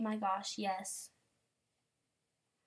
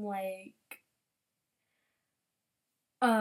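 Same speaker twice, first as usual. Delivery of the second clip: ordinary talk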